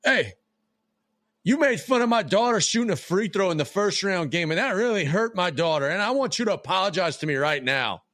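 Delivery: angry parent. Retold or imitated. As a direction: call him out like an angry parent. Angry parent demands apology.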